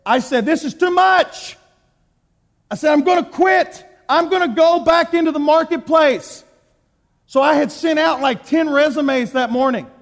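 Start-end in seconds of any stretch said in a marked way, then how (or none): 0.5-1.6 exasperated outburst. Retold or imitated. Telling pastor he'll quit. 2.9-6.4 declaring he'll quit. Retold or imitated. Telling pastor he'll quit.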